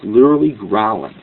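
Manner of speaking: normal talking voice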